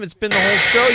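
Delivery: sudden loud jolt; loud exclamation. Real, not acted. Loud sound effect hits.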